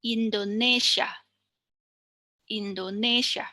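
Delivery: Indonesia with the NE syllable stressed; stress on penultimate syllable. Shown as a demonstration. Teacher shows students the stressed syllable in Indonesia, NE stressed. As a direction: lean on NE in Indonesia. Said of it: "the penultimate syllable is".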